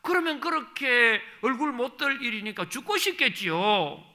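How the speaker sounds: loud, high, impassioned sermon; preaching at full force. Acted. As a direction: thunder it from the pulpit. Preacher rails against suicide.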